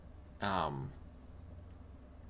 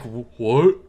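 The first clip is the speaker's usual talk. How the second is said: flat, droning monotone voice; 6 dB louder than usual. Retold or imitated. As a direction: say it in a dull monotone. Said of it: "in his monotone voice".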